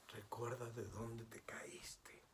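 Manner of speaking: slow, hushed whisper. Real, not acted. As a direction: whisper it slowly and gravely. Solemn admonition.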